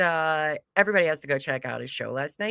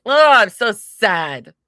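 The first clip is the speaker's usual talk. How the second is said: slow, louder mock whine; mock sadness; 5 semitones higher, 12 dB louder, slower than usual. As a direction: ham up the pity. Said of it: "I'll be like".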